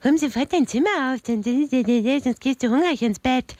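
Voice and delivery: fast, mocking sing-song imitation of her mother; teen mimicking her mother behind her back; mocking mom's nagging. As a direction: ape mom's scolding with a sneer. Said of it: "Mann, die nervt, ey".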